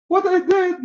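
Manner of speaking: very high incredulous mock protest; bewildered accused. Accused yawner asking the judge about the crime, high-pitched bewilderment. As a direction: squeak out a baffled question.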